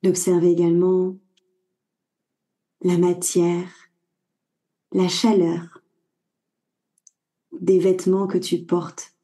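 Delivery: calm Yoga Nidra voice with long pauses; guided meditation. Acted. Guide leading a listener through body awareness before sleep, guided meditation. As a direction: guide the listener inward in short, unhurried phrases.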